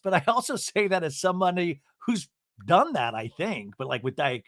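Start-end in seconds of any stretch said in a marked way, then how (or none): none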